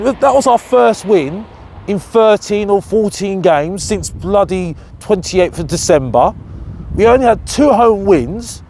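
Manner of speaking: ordinary delivery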